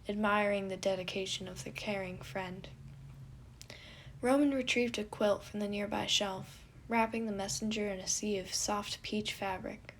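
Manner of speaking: plain reading voice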